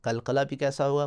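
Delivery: ordinary talk, nothing marked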